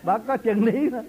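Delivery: loud, high, slow through laughter; laughing. Real, not acted. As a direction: say it loudly while laughing. Đùa rằng bà có chân lý. (vừa cười vừa nói to)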